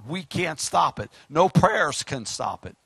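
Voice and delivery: ordinary delivery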